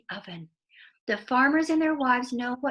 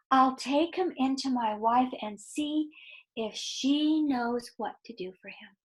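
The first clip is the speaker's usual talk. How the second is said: put-on expressive read-aloud to children; lively storyteller voice for kids; story narration; 3 dB louder than usual. A narrator's reading. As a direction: tell it like a bedtime story, drawing them in.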